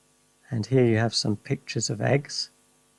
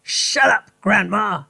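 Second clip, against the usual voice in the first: higher, curt father's voice; Mister Cranky tells Grandma to hush, higher; Mister Cranky snapping; 8 semitones higher than usual. Acted. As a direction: brush her off. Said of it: "he said".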